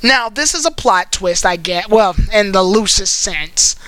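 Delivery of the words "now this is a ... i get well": usual speaking voice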